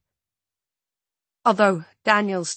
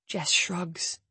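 plain reading voice

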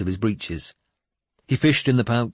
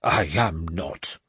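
stiffly; 3 semitones lower, slower than usual. Acted. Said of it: "Swithred said stiffly".

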